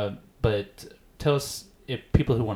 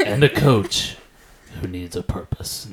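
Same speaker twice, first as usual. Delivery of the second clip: booming trailer narrator, fading out; movie trailer voice; 5 dB louder than usual. Pretend. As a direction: finish the pitch like a trailer voiceover. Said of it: "I don't do the good movie trailer voice guy".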